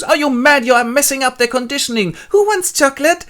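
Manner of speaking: high, loud, flustered nurse; scold, then call out to children, high and loud; outraged nurse. Acted. Nurse sees John shaking a child, outraged high scolding.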